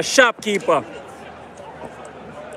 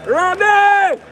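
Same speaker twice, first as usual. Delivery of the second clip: high, loud call across the crowd; 12 semitones higher, 3 dB louder than usual. Real, not acted. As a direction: shout his name to get his attention. Calling out to Robbie again.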